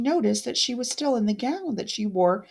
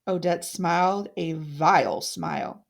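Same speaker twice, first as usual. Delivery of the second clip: normal talking voice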